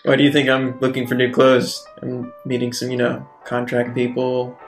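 ordinary talk, nothing marked

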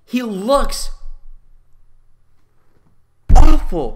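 a high, loud blast on awful; loud disgust. Real, not acted. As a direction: spit out awful as loud as you can. Ranting about Jar Jar again.

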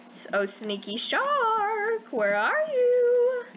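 high, slow singsong call to the hidden shark; playful high drawn-out calling; singsong taunt. Real, not acted. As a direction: tease it out like calling a pet.

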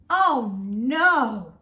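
plain reading voice

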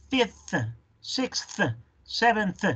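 "fifth", "sixth", "seventh" high, with th puffed out separately; slow practice "seventh", th detached at the end; exaggerated final th. Shown as a demonstration. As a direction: overdo the th ending "sixth", slightly ridiculous. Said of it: "Al principio suena un poco ridículo".

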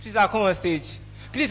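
ordinary talk, nothing marked

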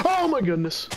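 high, startled cry; startled exclamation. Real, not acted. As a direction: yelp it out in surprise. Falls into a hole.

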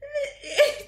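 high-pitched giggle; high squealing laugh. Real, not acted. Vlogger laughing at Faith's entrance.

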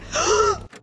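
high, panicked gasping; frightened gasps. Real, not acted. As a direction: gasp and whimper with a pounding heart. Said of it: "My heart is pounding".

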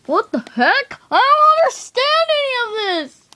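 high, loud confused wail; exasperated outburst. Pretend. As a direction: wail in frustration. Confused by math book.

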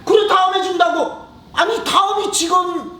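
high, drawn-out teasing; mocking bully. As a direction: stretch it out to annoy. Bully mocking word games.

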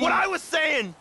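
high, loud barking of authority; angry shout. Acted. Agent yells at the crew that he's in charge. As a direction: yell that you're in charge. Boss yelling back.

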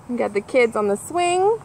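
usual speaking voice

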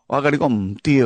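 ordinary delivery

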